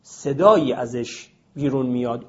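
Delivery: ordinary delivery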